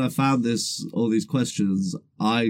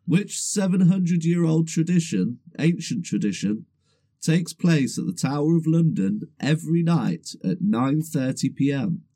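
quiz host; 4 semitones higher than usual. Acted. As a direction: read it out like a game show host. Quiz host reading question.

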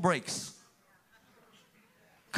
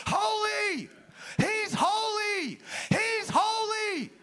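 high, slow screamed holy; screaming; 11 semitones higher, much slower than usual. Acted. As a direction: scream it at the person next to you. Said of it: "Why am I screaming?".

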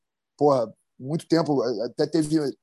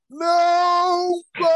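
high, loud, long drawn-out cry of "Não vai!"; warning shout; 13 semitones higher, 6 dB louder, much slower than usual. Retold or imitated. Bodyboarder warns the surfer off the wave, stretched high shout. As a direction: yell it out slow and stretched, trying to stop him. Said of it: "o cara olhando pra mim e falando assim".

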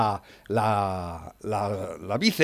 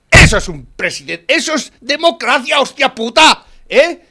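loud, much higher ranting; heated rant; 8 semitones higher, 9 dB louder than usual. Real, not acted. Man ranting to his friend about Trump, loud and heated. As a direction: shout it out in fired-up admiration.

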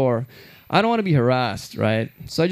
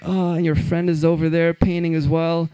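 ordinary delivery

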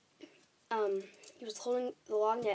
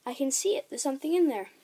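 higher, louder exclamation; amazed, raised voice; excited cry; 4 semitones higher, 7 dB louder than usual. A narrator's reading. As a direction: cry out at a discovery. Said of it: "cried out".

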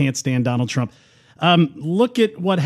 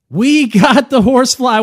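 higher, louder, hammed-up repeat; louder mock announcement; 7 semitones higher, 5 dB louder than usual. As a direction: punch it up the second time.

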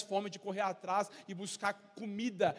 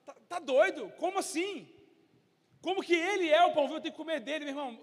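higher, louder, fast incredulous outburst with a laugh; baffled crowd; 7 semitones higher, 5 dB louder than usual. Acted. Preacher playing the scandalized crowd, higher and incredulous. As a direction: act out people who can't believe what they heard.

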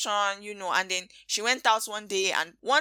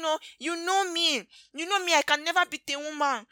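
pleading husband; 7 semitones higher than usual. Retold or imitated. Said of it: "he was being".

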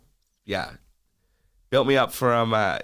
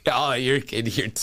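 ordinary talk, nothing marked

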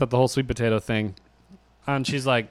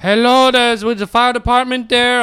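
higher and louder, a fussy landlord voice; landlord on the phone; 9 semitones higher, 5 dB louder than usual. Retold or imitated. Mimicking the landlord phoning about the fire truck, in a higher, louder put-on voice. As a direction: put on a worried landlord calling.

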